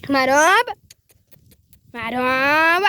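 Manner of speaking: chamado alto e esticado para longe; chamando a vaca. Real, not acted. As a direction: chame o animal lá do fundo do pasto. (chamando a vaca bem alto)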